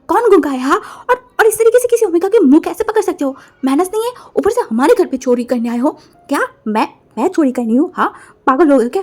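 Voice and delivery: loud high angry retort; furious scolding; indignant quarrel. Acted. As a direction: snap back outraged.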